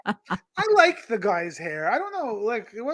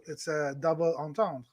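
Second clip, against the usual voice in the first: softer, lower French-style "double entendre"; French pronunciation; 5 semitones lower, 8 dB quieter than usual. Shown as a demonstration. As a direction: say it the French way, final syllable swallowed. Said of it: "I speak French".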